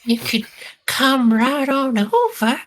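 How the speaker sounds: flirty character voice. Acted. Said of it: "when you go into voices".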